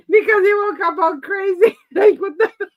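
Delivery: high, loud, laughing mock startle; laughing, hammed-up shock. Sister-in-law retelling brother's startled wake-up, laughing. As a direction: laugh through his startled yell.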